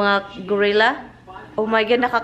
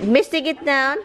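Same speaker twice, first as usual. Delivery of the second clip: ordinary talk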